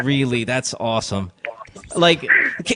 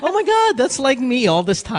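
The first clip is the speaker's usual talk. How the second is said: high, excited mock gasp; mock realization; 8 semitones higher than usual. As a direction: squeal it like a revelation. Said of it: "mocking caller realizing".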